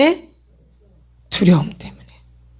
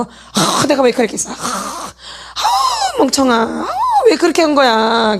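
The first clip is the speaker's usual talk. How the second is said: groaning, sighing mock self-blame; exasperated groans at your own stupidity, hammed up; acted self-reproach; slower than usual. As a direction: act out berating yourself with long, heavy sighs. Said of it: "나를 막 학대하니까".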